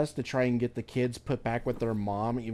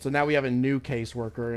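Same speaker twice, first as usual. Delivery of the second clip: normal talking voice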